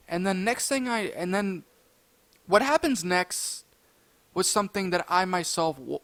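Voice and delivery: ordinary delivery, nothing marked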